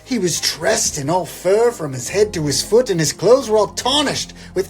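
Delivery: grand, theatrical poem reading over music; poem recital. Acted. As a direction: read it like a big Christmas classic.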